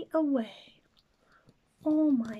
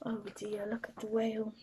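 plain reading voice

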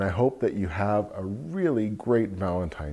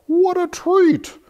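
delighted squeal; 6 dB louder than usual. Acted. Cow exclaims in picture book.

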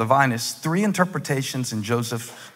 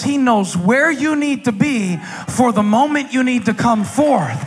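high, forceful pulpit delivery; impassioned preaching; 11 semitones higher, 3 dB louder than usual. Real, not acted. Preacher urging the congregation toward God, impassioned. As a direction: preach it hard, drawing out the last words.

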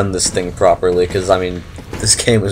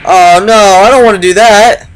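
loud, high alarmed cry; panicking gamer; 10 semitones higher, 8 dB louder than usual. Real, not acted. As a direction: blurt it out in alarm. Light turned off in game.

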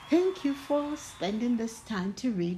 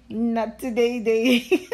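laughing; 5 dB louder than usual. Real, not acted. Storyteller laughing at the book's silly day names. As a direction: giggle through the punchline.

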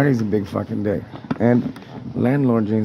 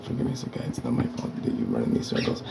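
hushed whispered apology; whispered aside; 7 dB quieter than usual. Real, not acted. A tenant apologising under his breath to a landlord, whispering.

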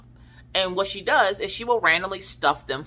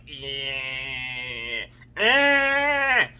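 giddy excitement. Retold or imitated. Giddy and excited Christmas morning.

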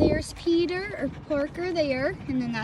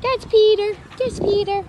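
much higher and louder, sing-song to the animal; pet talk; 8 semitones higher, 6 dB louder than usual. Real, not acted. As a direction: coo his name at him brightly. Talking to Peter himself.

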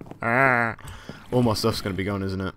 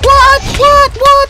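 high, very loud shrieks; shrieking in panic; 20 dB louder, much slower than usual. Real, not acted. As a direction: shriek each word in disbelief. Suddenly attacked, panicking.

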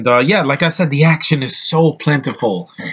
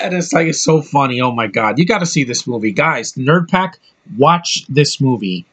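ordinary delivery

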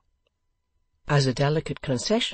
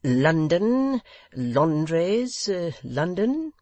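loud, higher, slow repetition with rising ends; pompous host spelling out the city; loud over-explaining; 4 semitones higher, 5 dB louder, much slower than usual. A narrator's reading. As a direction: hammer the word home for a foreigner.

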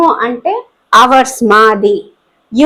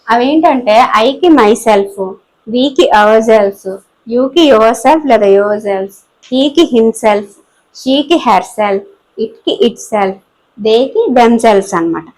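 slow listing; 4 semitones lower, much slower than usual. Shown as a demonstration. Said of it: "teacher lists pronoun pairs".

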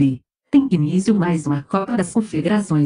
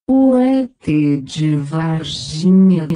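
slow motion replay; much slower than usual. Acted. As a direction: drag every word like a slow replay. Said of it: "pede para ver o replay".

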